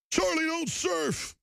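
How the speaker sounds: high, drawn-out movie-quote shout; Robert Duvall. Acted. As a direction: holler it slowly like a war-film officer. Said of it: "I love Robert Duvall".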